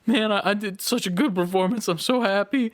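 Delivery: high-pitched mock happy crying; mock tearful. Imitating a crying actor thrilled with his own performance, mock tearful. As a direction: imitate an actor getting choked up with joy. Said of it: "he's, like, crying".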